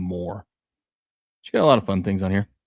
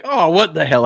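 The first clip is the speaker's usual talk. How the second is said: put-on raised, louder kid-like outburst; imitating three-year-old; 8 semitones higher, 6 dB louder than usual. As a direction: play a little kid's indignant surprise. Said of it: "That's my three-year-old".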